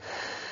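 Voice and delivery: f said as a soft hiss of air; f sound. Shown as a demonstration. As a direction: breathe out a quiet f. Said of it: "les deux ensemble vont chanter".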